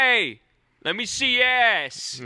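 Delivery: high, loud mock catcall. Wiseguy hollering at a painting that ignores him, high loud catcall. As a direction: holler at her across the hall.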